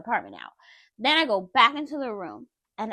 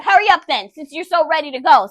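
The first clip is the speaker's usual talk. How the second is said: loud, higher angry shout; retold shout; 4 semitones higher, 8 dB louder than usual. Telling ex to hurry and leave, loud angry shout. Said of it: "I shouted".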